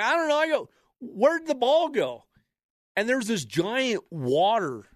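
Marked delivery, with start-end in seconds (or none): 0.0-2.2 high, sheepish called reply; calling back. Retold or imitated. Golfer calling back to his friend, confused. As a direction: call back down the hill, confused.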